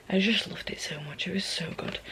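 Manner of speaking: breathy dreamy whisper. Acted. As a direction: whisper it like a secret. Gushing about a book, whispering.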